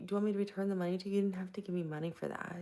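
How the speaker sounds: ordinary talk, nothing marked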